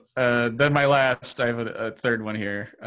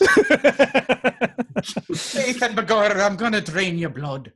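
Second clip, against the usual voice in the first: higher, hammed-up Irish villain; terrible Irish accent; 8 semitones higher than usual. Past-life vampire threatening to drain blood, badly faked Irish accent. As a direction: put on a bad old-time Irish accent. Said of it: "in the terrible Irish accent".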